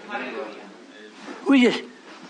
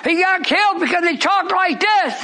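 full-force preaching; 6 semitones higher, slower than usual. Real, not acted. Preacher echoing scripture back to the congregation, fervent shout. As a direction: echo the reader and hammer each phrase. Preaching Stephen's sermon with call and response.

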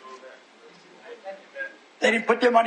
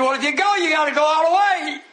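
raised, ringing orders to a demon; stern preaching command; 6 semitones higher than usual. Retold or imitated. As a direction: lay down the law in a preacher's cry. Preacher lays down terms to devil.

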